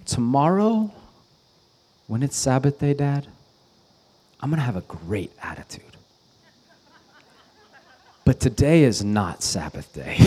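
put-on child's earnest bargain. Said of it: "and he goes".

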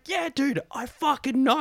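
high-pitched exasperated retort; mock exasperation. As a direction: squeal it back at the know-it-all, hammed-up. Retold exasperated retort to pub stranger.

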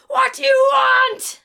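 very high, drawn-out shout; explosive comic dad bellow; angry dad. Acted. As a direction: yell back like a fed-up parent. Acted dad snapping at child.

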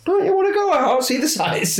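high-pitched, hammed-up Northern English accent. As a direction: put on a jokey Northern accent for the receptionist. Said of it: "I don't know why I'm doing that accent".

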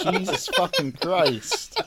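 laughing, drawn-out, high-pitched exclamation; real incredulous laughter through slow words; laughing disbelief. Real, not acted. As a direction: crack up while stretching out the oath. Bursts out laughing at the description.

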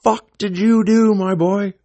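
high, loud mock screaming; imitated screaming dad. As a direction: bellow it like an irate father down the phone. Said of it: "he's screaming at me on the phone".

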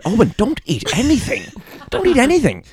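father hissing at his son to stop; mock dad scolding. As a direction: snap at the kid in a fluster. Retold dad scolding his child.

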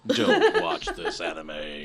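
low, slow mock warning; mock ominous. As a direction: warn them like a movie trailer.

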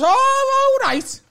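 high, loud "oh" easing off as he stumbles; mock realisation. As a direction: imitate a man suddenly catching on. Acted stranger finally understands comedian.

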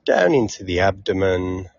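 unhurried meditation guide, long pauses between phrases; calm, measured relaxation cues with lingering silences; guided meditation. Acted. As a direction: ease them through a body scan, leaving space after each phrase.

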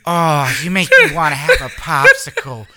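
slow, drawn-out anyway in a sultry diva voice; Coolidge impression. Shown as a demonstration. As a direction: stretch it lazily like the diva. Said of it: "say anyway".

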